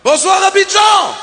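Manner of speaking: big slow shout to the crowd; crowd greeting shout. Acted. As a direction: greet the whole arena loudly. (salut crié à la foule)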